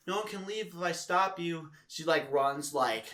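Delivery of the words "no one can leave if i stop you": lightly voiced high-pitched protest; imitated drunk partygoer. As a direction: imitate her refusing to let anyone go. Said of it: "she's like".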